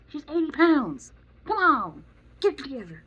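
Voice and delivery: high, loud frustrated scolding; exasperated outburst. Real, not acted. To careless parents, loud high exasperated scolding.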